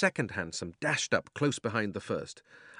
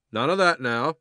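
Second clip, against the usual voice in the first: loud, stern rebuke; 4 semitones higher, 7 dB louder than usual. A narrator's reading. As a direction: cut him short firmly. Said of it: "said the policeman sternly".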